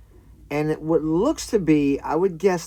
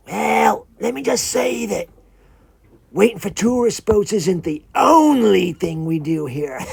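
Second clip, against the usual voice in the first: loud, higher iguana character voice; knowing creature with a secret to share; talking iguana; 3 semitones higher, 7 dB louder than usual. Acted. Iguana showing off to the visitor, knowing put-on voice. Iguana hints at side business.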